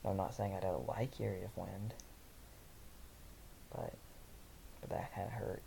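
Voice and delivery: plain reading voice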